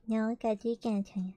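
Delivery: ordinary delivery, nothing marked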